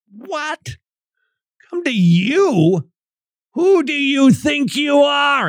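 high, stunned disbelief; "who do you think you are", squeaked high; incredulous outrage. Retold or imitated. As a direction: voice the shocked crowd. Imagined hearer outraged at claim.

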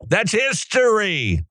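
slow, high-pitched mocking; drawn-out sarcastic jab; mock wide-eyed sarcasm. Real, not acted.

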